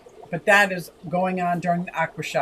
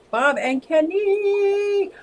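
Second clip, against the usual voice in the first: drawn-out greeting; 11 semitones higher, much slower than usual. Real, not acted. Stretched high greeting.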